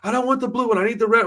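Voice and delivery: put-on high, pleading little girl; playful imitation of a demanding kid; whiny kid voice. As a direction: mimic a fussy child wanting her way. Child voiced in retold scene.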